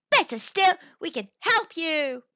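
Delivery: high, loud, keen offer; eager. A narrator's reading. Mouse offering to help plan the bee's party, eager.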